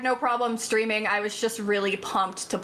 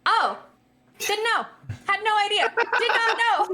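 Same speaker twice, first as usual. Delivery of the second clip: high-pitched, protesting innocence; exaggerated high "who, me?" denial; acted-out cluelessness; 11 semitones higher than usual. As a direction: plead ignorance in a much higher voice. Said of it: "and me being like".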